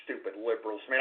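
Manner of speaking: usual speaking voice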